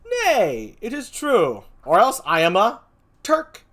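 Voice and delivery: high, loud stage insistence; theatrical protest. Acted. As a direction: insist loudly, pitch leaping up. Acted protest in the play.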